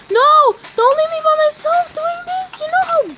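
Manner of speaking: very high, urgent pleading; high-pitched panicky protest; panicked plea. Real, not acted. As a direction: cry out not to be abandoned. Pleading not to be left alone.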